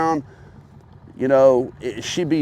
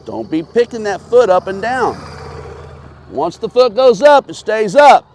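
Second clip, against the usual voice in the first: calling out; 7 semitones higher, 7 dB louder than usual. Real, not acted. Coach calling to rider on bike.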